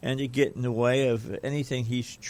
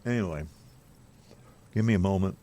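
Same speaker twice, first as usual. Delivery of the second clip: mouth full; 3 semitones lower, much faster than usual. Real, not acted. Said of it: "I put some food in my mouth".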